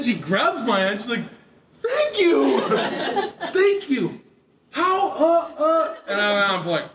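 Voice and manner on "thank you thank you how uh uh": gushing thanks. Retold or imitated. Grateful woman, laughing.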